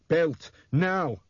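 loud, high-pitched order; urgent command. A narrator's reading. Nick ordering a slow helper, loud urgent command. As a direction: demand it sharply and loudly.

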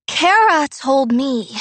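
slurred, tipsy accusation; drunk slurring. A narrator's reading. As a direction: slur it a little, loud. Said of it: "slurring the edges of her words".

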